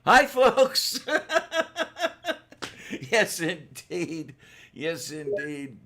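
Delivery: high, loud, laughing showman greeting; showman host voice. Acted. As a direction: throw open the show like a cackling host. Over-the-top show opening.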